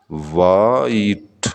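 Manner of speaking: White drawn out very slowly; slow syllable demo. Shown as a demonstration. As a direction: hold white long as one beat. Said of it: "white में कितने". ("White" बहुत खींचकर)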